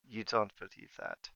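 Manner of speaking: low and soft, doubting; quiet menace. Acted. Towering Empress doubting Marta's excuse, low and soft. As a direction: hush it, a cold rebuke. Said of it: "And she goes".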